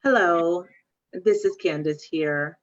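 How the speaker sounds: ordinary delivery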